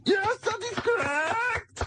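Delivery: put-on high, slow German accent; hammed-up German officer accent; Nazi accent. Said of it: "you're doing the, uh, Nazi accent very well".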